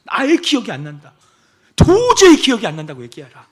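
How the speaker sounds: loud, building sermon; fervent preaching. Acted. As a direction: preach it with fire. Preaching at full force.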